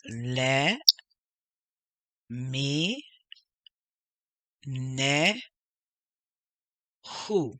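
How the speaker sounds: LE, MI, NE, HU with long gaps; very slowly sound out le ... mi ... ne ... hu; each syllable held and set apart. Shown as a demonstration. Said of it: "So we have".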